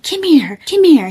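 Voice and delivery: high, sharp "come here" called out; mock the boyfriend yanking her along; imitating an angry boyfriend; retold yelling. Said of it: "he's, like, tugging on my arm".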